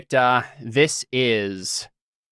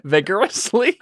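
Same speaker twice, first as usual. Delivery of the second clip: ordinary talk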